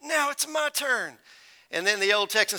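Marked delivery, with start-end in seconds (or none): 0.0-1.2 high, soft, strained mock-hurt voice; barely able to speak. Kicked attorney finally getting his turn, acting barely able to speak. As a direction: act too battered to speak up. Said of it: "the attorney could barely speak".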